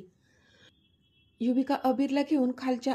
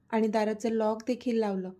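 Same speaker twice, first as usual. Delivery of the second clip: plain reading voice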